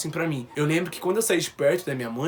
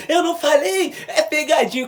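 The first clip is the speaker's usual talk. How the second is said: much higher and louder, gleeful; triumphant shout; 11 semitones higher, 6 dB louder than usual. Retold or imitated. Son spotting the camera, gleeful triumphant shout. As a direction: shout it like you caught them out.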